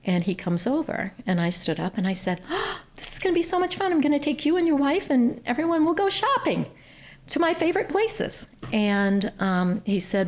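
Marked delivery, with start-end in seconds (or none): 2.5-8.5 high, gushing reenactment of her own delight; excited gushing. Retold or imitated. Offering a donor a shopping trip, excited high gush. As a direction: squeal it like a giddy bargain hunter.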